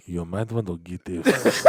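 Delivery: a quiet mock threat from the elf squad; menacing elf. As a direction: hush it like an armed elf warning. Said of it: "they're like".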